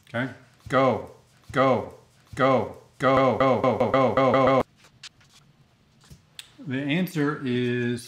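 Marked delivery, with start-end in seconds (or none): 0.7-4.6 loud urging. Acted. Urging viewers to answer fast.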